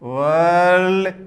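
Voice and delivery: वर्ल्ड stretched slowly, holding the r-l before the d; drawn-out sounding out. Shown as a demonstration. Teacher shows where the l comes in वर्ल्ड, drawn out. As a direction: draw out वर्ल्ड as one long syllable. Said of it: "अब ला आया है".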